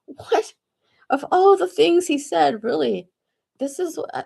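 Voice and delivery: high, disbelieving outburst; incredulous. Retold or imitated. She reacts to the TV edit, high and incredulous. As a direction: ask it in outraged disbelief. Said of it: "I was like".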